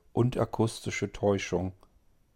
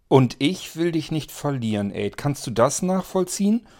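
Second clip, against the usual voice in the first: higher, louder, pleading reproach; reproachful; 6 semitones higher, 4 dB louder than usual. Acted. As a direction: reproach him with a serious face. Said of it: "sehr vorwurfsvoll mit sehr ernster Miene". (vorwurfsvoll und ernst, höher und lauter)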